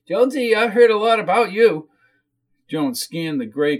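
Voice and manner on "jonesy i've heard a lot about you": high, louder whiny woman's voice; whiny character voice, pitched up; whiny hostess. Acted. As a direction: gush at the guest in a high whine.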